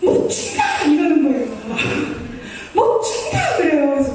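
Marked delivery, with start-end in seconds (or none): 0.0-0.6 very high-pitched mock wail; imitating a woman bursting into tears; imitated tearful outburst. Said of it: "갑자기 울면서". 2.7-3.6 high mock-crying cry; hammed-up weepy refusal; imitated tearful outburst. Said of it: "갑자기 울면서".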